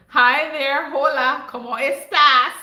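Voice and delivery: big, loud cheerful hello to the chat; loud greeting. Real, not acted. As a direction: call out the welcome, slowing on cómo estás. Greeting viewers on a livestream.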